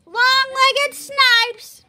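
loud, high, drawn-out jeer; high-pitched mocking boy's yell. A narrator's reading. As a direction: yell it slowly as a schoolyard taunt. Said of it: "a freckled boy with fire-colored hair yelled".

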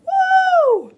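very high, loud whoop of delight; a joyful holler at the glory; loud, high cheer; excited woo. Real, not acted. Exclaiming at heaven's light.

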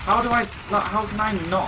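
high, loud baffled protest; exasperated disbelief. Real, not acted. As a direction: protest loudly, unable to believe it. Failing in the game.

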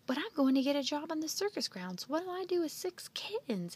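high-pitched dismayed objection; crying out in protest. A narrator's reading. Andy refusing six kittens from Joe, crying out in protest. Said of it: "Andy cried".

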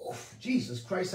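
normal talking voice

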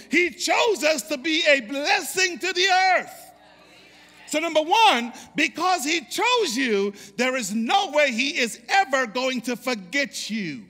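building to a loud, high, sung-out climax; preaching at full force. Acted. Pastor whips the congregation into a climax, loud and soaring. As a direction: preach it up until it rings.

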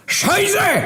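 very high howled German curse; shrill machine yelp of alarm. Acted. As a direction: let the car howl it out. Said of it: "zawył głośno".

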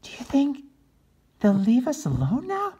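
high anxious question; worried turkey. Acted. Regina asking while fleeing the Pilgrims, anxious. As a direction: ask it fearfully while running. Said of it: "Regina asked as they ran".

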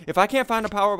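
usual speaking voice

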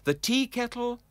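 ordinary delivery, nothing marked

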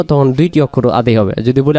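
ordinary delivery

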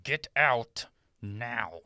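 low, slow, stern through gritted teeth. Acted. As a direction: hold back rage through clenched jaw. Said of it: "speaks to her sternly through gritted teeth".

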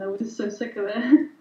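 ordinary talk, nothing marked

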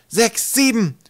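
high, loud counting shout. Acted. Twig counting during the game, high shout. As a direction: call the numbers out across the field.

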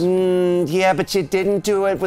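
Deb. Pretend. Accountant citing the proper form, officious. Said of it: "Deb just comes out".

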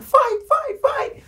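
very high squealed chant; imitated kid screaming. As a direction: shriek it like an excited schoolkid. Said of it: "The kid starts screaming like".

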